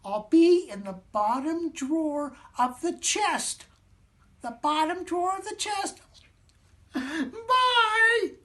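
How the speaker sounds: very high, proud little squeak; squeaky matzo man. Acted. Matzo man revealing his hiding place, high squeak. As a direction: boast in a tiny voice.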